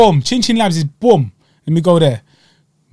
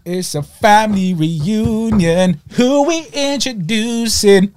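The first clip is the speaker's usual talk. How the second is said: drawled host intro; 5 semitones higher, much slower than usual. Pretend. Mock intro catchphrase.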